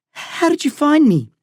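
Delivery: put-on a scared kid blurting it out; frightened boy. A narrator's reading. Frightened boy on strange call.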